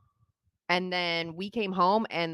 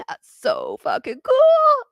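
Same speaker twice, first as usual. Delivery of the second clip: amazed; 8 dB louder, slower than usual. Retold or imitated. Awed child reacting to neighbor.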